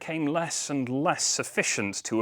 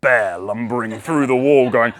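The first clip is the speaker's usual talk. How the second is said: bear roar; 14 dB louder than usual. Acted. Bear sound at the wall.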